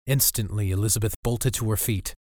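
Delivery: plain reading voice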